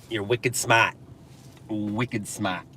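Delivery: put-on understated Boston-accent catchphrase. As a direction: drop the R like a Bostonian.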